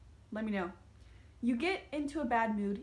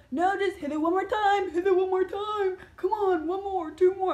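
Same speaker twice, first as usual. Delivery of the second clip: higher, louder pushy friend voice; pushy stoners; 8 semitones higher, 8 dB louder than usual. Retold or imitated. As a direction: egg her on to smoke more.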